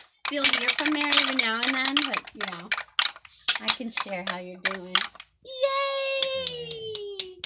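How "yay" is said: high happy cheer; excited cheer. Real, not acted. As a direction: cheer him on, high and bright. Cheering toddler's success.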